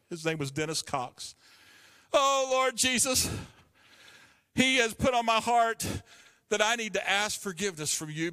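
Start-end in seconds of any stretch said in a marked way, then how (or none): 2.1-8.3 a weeping student apologising to the dean; tearful crying plea. Retold or imitated. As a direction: break down and beg forgiveness.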